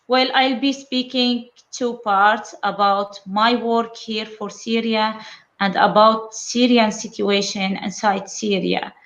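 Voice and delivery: normal talking voice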